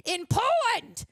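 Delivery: high, swooping hammed-up cry; mock dramatic director. Reviewer imitating the director writing his life into the script, mock dramatic. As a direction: overblown soap-opera outburst.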